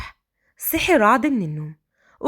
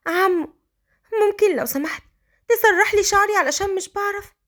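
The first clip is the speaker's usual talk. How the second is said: put-on lightly raised little girl, shy request; a young orphan girl asking politely; timid child asking her uncle; 7 semitones higher than usual. A narrator's reading.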